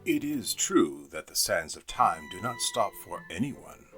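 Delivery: plain reading voice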